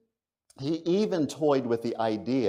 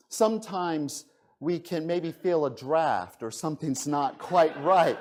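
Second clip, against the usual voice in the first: ordinary talk, nothing marked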